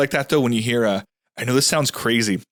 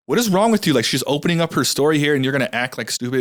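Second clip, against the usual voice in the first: angry outburst; 5 semitones higher than usual. Retold or imitated. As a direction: snap at her for mocking the woman. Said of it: "I went off on this girl".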